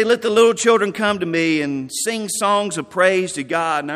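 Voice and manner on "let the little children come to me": put-on louder, quick, bright calling out to kids; Robert's cheerful call. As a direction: call the children over with joy. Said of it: "He would say".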